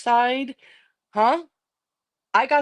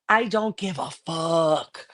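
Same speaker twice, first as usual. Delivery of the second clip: slow, stretched I DON'T GIVE A FUCK; defiant, each word pulled long; drawn-out defiance; 2 semitones lower, slower than usual. Real, not acted. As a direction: drag out every word for emphasis. Defiant repeat, drawn out for emphasis.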